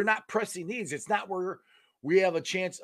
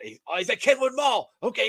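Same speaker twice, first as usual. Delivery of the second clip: normal talking voice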